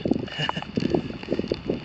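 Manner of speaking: soft unvoiced chuckle; quiet breathy laugh. Real, not acted. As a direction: laugh under your breath. Laughs at blanking.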